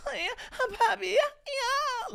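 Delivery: very high, squeaky fake moans; mock moaning. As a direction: squeal it in hammed-up pleasure. Acted mock lovemaking cries.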